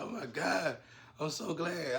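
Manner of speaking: high, loud burst of relief; relieved exclaim. Retold or imitated. The girl in the hotel seeing her rescuer, excited relief. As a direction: gasp it out, glad he's here. Woman relieved to see him.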